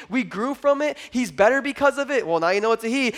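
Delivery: usual speaking voice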